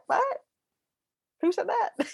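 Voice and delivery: mock stunned question, way up high; high disbelieving squeak; stunned disbelief. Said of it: "you're just like".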